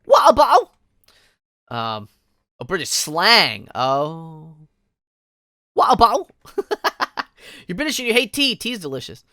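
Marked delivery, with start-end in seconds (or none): 0.1-0.7 high, loud mock English accent on "Water bottle". Shown as a demonstration. Streamer demonstrating British slang, exaggerated English accent. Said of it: "water bottle with an English accent". 5.8-6.3 loud, high mock British "Wow, bow"; English accent. Shown as a demonstration. Streamer repeating British slang, exaggerated English accent. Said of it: "water bottle with an English accent".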